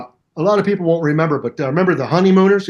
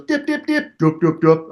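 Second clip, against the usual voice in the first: tapping wood sounds. Retold or imitated. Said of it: "when Sheldon taps it, it goes".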